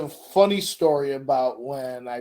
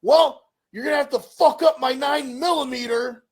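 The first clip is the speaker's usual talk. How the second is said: high, loud mock dad comeback; dad firing back with a threat; dad answering the caller; defiant dad; 9 semitones higher, 4 dB louder than usual. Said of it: "my dad goes".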